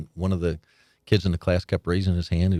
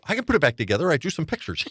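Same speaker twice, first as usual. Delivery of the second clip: put-on higher, eager boyish pride; proud excited kid; 9 semitones higher than usual. Boy proudly telling his father about the rebuilt tractor, excited and higher. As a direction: show Dad the job like a proud kid.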